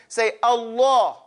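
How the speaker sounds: Allah slow, the lam thick and rounded; slow Allah with a round mouth; heavy lam. Shown as a demonstration. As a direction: make the lam in Allah big and fat. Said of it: "You're saying the lamb with a fakhm".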